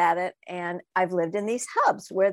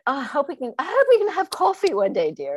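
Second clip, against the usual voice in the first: imitated British accent; 6 semitones higher, 5 dB louder, faster than usual. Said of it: "she had this great British accent".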